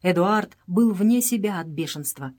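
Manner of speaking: usual speaking voice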